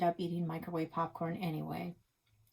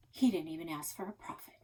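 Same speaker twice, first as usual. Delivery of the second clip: ordinary delivery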